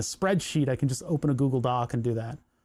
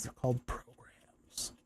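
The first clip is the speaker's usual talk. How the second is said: slow sarcastic whisper; 4 semitones lower, 12 dB quieter, slower than usual. Acted. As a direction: lean in and whisper it mockingly. Sarcastic aside about programs.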